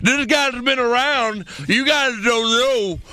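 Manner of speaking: put-on slow, high old wrestler voice; Ric Flair impression. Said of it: "Ric Flair gets on the".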